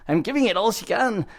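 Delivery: Scotty. Acted. Engineer calling to the captain, hammed-up Scottish accent. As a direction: badly imitate a straining Scottish engineer. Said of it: "I don't do a great Scotty imitation".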